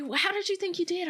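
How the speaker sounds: high, quick imitation of an interviewer; eager interviewer. Dancer imitating the interviewer questioning her after the show, high eager voice. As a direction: pitch it up and ask eagerly, as the host. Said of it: "And she's like".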